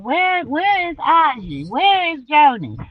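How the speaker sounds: put-on slow, high child's call; drawn-out kids asking for auntie. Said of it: "talking about some".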